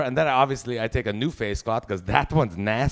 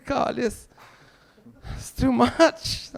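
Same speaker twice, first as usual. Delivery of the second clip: exhausted whine; 10 semitones higher, much slower than usual. Retold or imitated. Exhausted complaint.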